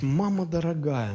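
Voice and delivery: usual speaking voice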